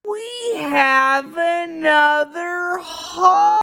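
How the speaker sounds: very high, long-held mock wail; Charlie Brown. Charlie Brown frets about the holidays, drawn-out high cartoon wail. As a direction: stretch every word into an over-the-top anguished howl. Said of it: "And Charlie Brown says".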